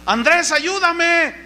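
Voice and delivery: a high, slow, pleading call for rescue; a sinking Pedro calling out to the wrong person, mock plea; cry for help. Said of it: "dio voces diciendo".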